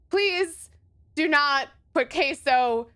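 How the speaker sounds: ordinary talk, nothing marked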